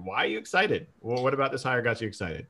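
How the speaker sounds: usual speaking voice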